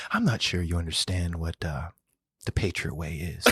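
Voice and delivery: soft, near-whispered warning from the coach; calm coach whisper. Retold or imitated. Head coach quietly scolding his receiver, hushed calm warning. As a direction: keep it hushed and eerily calm. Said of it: "he said it just calm like this".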